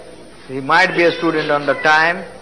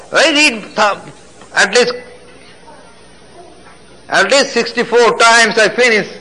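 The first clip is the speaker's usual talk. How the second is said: impassioned testimony; 5 semitones higher than usual. Acted. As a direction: testify with rising fervour. Testimony preached at full force.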